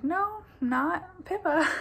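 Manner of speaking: slow, high, teasing no; high, slow mock correction; drawn-out correction. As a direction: stretch it out, gently correcting. Retold reply, slow, high, voiced differently.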